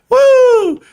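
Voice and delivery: high, loud real woo; celebratory whoop. Real, not acted. Streamer cheering the stock's highs with a loud high whoop. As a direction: let out a joyful cheer as the stock spikes.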